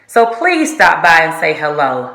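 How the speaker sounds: ordinary talk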